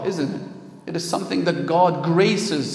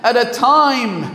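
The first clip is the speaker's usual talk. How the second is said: loud, raised sermon voice; forceful preaching; 6 semitones higher, 6 dB louder than usual. Acted. Preacher defending Khadija's honour to the congregation, forceful preaching. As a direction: preach it with full conviction, stretching key phrases.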